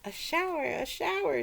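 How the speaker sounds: high, loud gleeful cry; mock children shrieking with joy; children screaming. A narrator's reading. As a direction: shriek it as you jump in. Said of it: "screamed Missus Ralph's four children".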